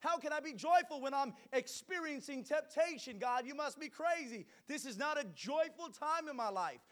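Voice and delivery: loud, high-pitched fiery preaching; booming motivational speech. Acted. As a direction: preach it out to the whole church. Preaching at full force.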